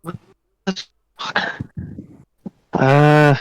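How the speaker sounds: usual speaking voice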